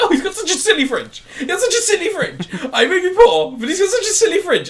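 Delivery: high, laughing mock voice; mocking people charmed by a comedian's fringe; mocking audience member. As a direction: squeal it like a gushing fan. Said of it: "people are like".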